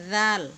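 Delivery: gentle voiced জাল as Arabic ذ; soft zal. Shown as a demonstration. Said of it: "জাল নরম হরফ". (জাল নরম করে উচ্চারণ)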